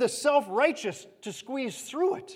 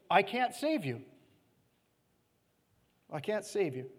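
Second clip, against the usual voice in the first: pleading, fading to a softer, lower I can't; crying out; 3 semitones lower, 4 dB quieter than usual. Acted. God crying out to his people, fading sorrow. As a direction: let the second I can't save you sink away. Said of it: "he's crying out to his own people".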